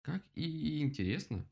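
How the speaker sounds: mild exclamation. A narrator's reading. Woodpecker marvels at the owl, exclaiming. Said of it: "воскликнул дятел".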